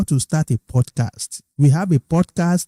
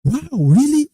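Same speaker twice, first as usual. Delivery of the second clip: amazed; 13 semitones higher than usual. Retold or imitated. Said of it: "he was like".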